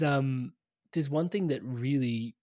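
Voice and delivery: normal talking voice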